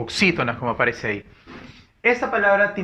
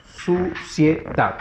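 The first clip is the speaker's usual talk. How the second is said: syllable by syllable; much slower than usual. Shown as a demonstration. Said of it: "Suciedad termina en dat".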